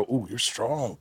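hushed mock-sexy praise; arm wrestling whisperer. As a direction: imitate a soft-spoken seductive coach. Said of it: "called the Arm Wrestling Whisperer".

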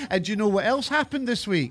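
ordinary talk

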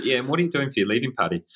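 brisk, hurried change of subject; quick segue. Retold or imitated. Boss quickly switching topics to the leaving party, rushed. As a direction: rattle it off fast to move on. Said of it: "he quickly segued to".

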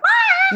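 very high startled shriek; the one who always gets scared; mock scream. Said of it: "the one that's like".

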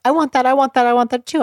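mock whining of an upset little one; upset child. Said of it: "Bryce all of a sudden gets upset".

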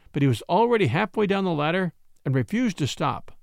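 ordinary delivery

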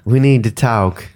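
gentle put-on Southern accent; lightly imitated soft Southern belle accent. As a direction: soften it into Southern vowels. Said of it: "It's soft and southern".

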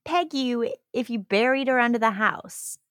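high-pitched, disbelieving challenge; incredulous. Real, not acted. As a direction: question it in high-voiced disbelief. Incredulous at the killer's boast.

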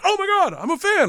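very high, excited outburst; imitated gushing fan. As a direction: gush in a high voice. Retold star gushing as fan.

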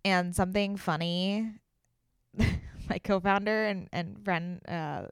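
ordinary delivery

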